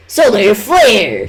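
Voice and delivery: slow, loud, high battle cry; shouting. Acted. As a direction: yell the attack name. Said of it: "shouted Azriel".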